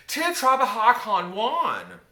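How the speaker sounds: slow, high shout in halting Spanish; loud phrasebook Spanish. Retold or imitated. Teenager coaching mother to ask for Juan, loud and slow. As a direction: call it out loudly, word by word.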